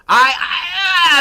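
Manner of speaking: high, loud stammering mock cry; mock wail. Streamer mock-wailing at a friend's scolding, high fake cry. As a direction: wail like you've been told off.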